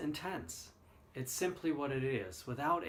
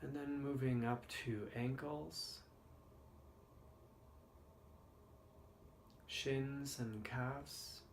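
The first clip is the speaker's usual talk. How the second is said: guided meditation; 6 dB quieter than usual. Acted. Leads the body scan meditation.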